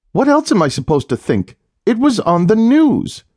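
defending herself to a teasing husband; upset wife protesting; indignant. A narrator's reading.

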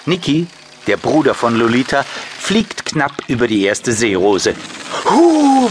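ordinary delivery

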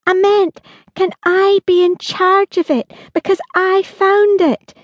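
put-on high, slow urgent girl's voice; a child insisting she has a claim; Holly urging her father, high and urgent. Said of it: "she said urgently".

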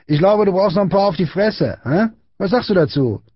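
high-pitched goading bully; threatening taunt. A narrator's reading. Big boy threatening Friedrich, high taunting voice. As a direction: goad him with a threat.

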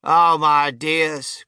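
very slow, loud lament; dismayed cry. A narrator's reading. Grandmother lamenting to her fellow passengers, slow dismayed cry. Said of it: "cried Grandma Georgina".

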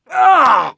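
high, loud pained yell; sudden agonized outcry; cry of pain. A narrator's reading. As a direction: yelp as the wound is jarred. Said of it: "he cried out".

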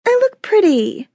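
put-on very high, sing-song bratty little girl; bossy little princess. A narrator's reading. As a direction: preen like a spoiled six-year-old. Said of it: "knew how to be a brat".

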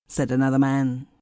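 usual speaking voice

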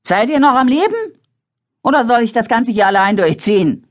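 high, loud yell through the rain; shouting. A narrator's reading. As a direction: shout over the storm to the others. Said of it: "brüllte sie".

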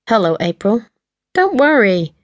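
smug, falsely cheerful greeting; gloating fake cheer with a lift on worry; mock cheery. A narrator's reading. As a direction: sound pleased you've put one over on her. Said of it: "in the mock cheery voice".

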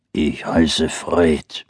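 low slow bear growl. Acted. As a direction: rumble it like a big bear. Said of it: "brummte der Bär".

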